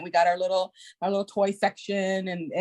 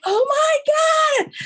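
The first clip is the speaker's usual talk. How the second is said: put-on thrilled kids; 7 dB louder, much slower than usual.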